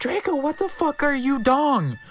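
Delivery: high, loud, hammed-up yell of outrage; shocked outburst. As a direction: belt it out like an outraged fangirl. Reader acts out a shocked outburst.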